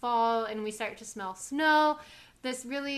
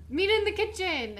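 Mrs Kim voice; 9 semitones higher, 3 dB louder than usual. Retold or imitated. Acting out Mrs Kim's order.

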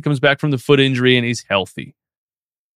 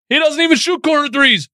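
exasperated rant; 10 semitones higher than usual. Real, not acted. Ranting about PJ Tucker.

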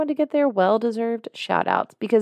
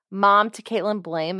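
usual speaking voice

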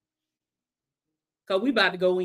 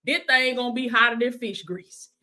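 ordinary talk, nothing marked

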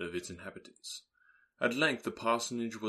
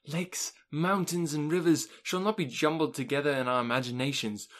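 high, louder, gushing delight; rapturous; 4 semitones higher, 3 dB louder than usual. Acted. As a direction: let the joy spill over about the trip. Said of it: "she rapturously cried".

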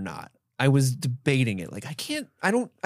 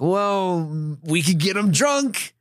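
higher, drawn-out scheming suggestion; character imitation; 5 semitones higher, much slower than usual. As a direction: put on a slow, pitched-up character voice. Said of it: "he's kinda like".

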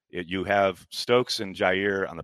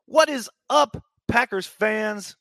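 high, loud, stretched-out hype welcome; hyped greeting; 12 semitones higher, 6 dB louder, slower than usual. Real, not acted. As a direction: call out to the fans like a hype man. Live stream host greeting viewers.

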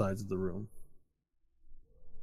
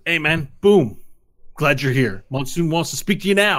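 loud, higher-pitched character voice; brisk street-guy greeter; 7 semitones higher, 10 dB louder than usual. Acted. Game master plays the greeter.

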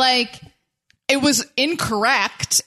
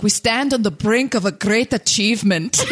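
put-on a laughing half-Scottish, half-Irish accent; bad Scottish accent, breaking into a laugh; a hammed-up Scottish brogue through laughter. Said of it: "it turned into a Scottish accent".

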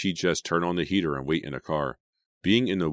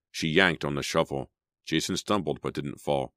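usual speaking voice

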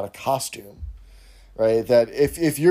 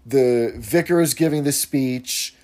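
normal talking voice

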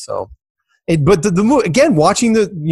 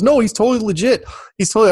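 insistent promoter; 10 semitones higher, faster than usual. Retold or imitated. Promoter insisting in retold argument.

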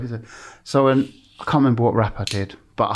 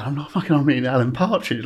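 normal talking voice

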